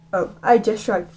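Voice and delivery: plain reading voice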